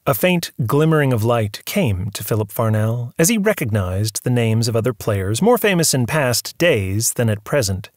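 ordinary delivery, nothing marked

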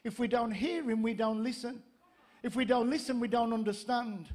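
ordinary talk

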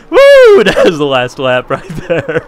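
loud high whoop; excited cheer. Real, not acted. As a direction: celebrate the finish loudly. Finishing the last lap.

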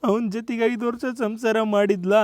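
slow, soft, miserable voice; mournful confession. Acted. Husband recounting her first marriage, mournful. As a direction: tell it as a sad tale.

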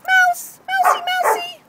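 high, squeaky pet talk; baiting the dog with a pretend mouse; pet-talk teasing. Real, not acted. Teasing dog with prey words.

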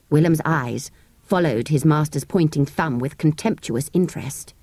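ordinary delivery, nothing marked

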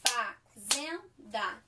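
Fazenda drawn out slowly, syllable by syllable; syllable-clapping. Shown as a demonstration. Teacher clapping syllables for children, slow and spaced. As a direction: clap out Fazenda slowly in three beats. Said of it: "bate palminha aí".

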